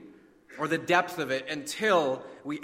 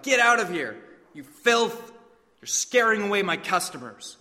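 angry shout, louder and higher; 4 semitones higher, 5 dB louder than usual. Acted. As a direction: drive the leper off with a furious yell. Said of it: "threw something at him and shouted".

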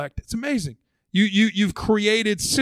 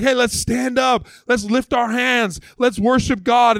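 slow, higher, lightly acted rallying call; rallying worship leader; 6 semitones higher, 4 dB louder, slower than usual. Worship leader to gathered church, lightly acted rallying call. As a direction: rouse the room, stretching each call.

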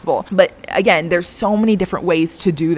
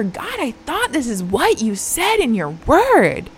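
higher, a touch indignant; a hint of a frustrated prayer; mild retold anger; 8 semitones higher than usual. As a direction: complain to God with a hint of hurt anger. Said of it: "like, outright anger".

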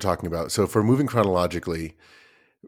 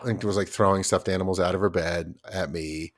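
ordinary delivery

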